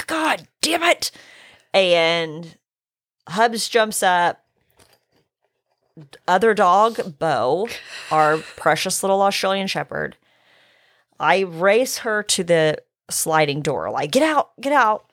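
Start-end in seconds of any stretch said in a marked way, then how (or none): 0.1-1.1 retold curse. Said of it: "And I was like". 14.1-15.1 urgent calling out. Retold or imitated. Chasing dogs out the door.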